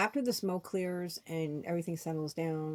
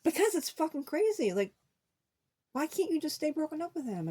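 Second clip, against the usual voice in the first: much higher, pitched-up exasperated retort from the friend; exasperated outburst; 11 semitones higher than usual. Retold or imitated. Friend scolding her for going back, in an exasperated outburst. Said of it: "He goes".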